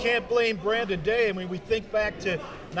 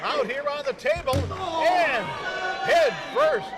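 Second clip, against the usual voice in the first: excited call; 5 semitones higher than usual. Real, not acted. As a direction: yell out the big table spot. Table spot during play-by-play.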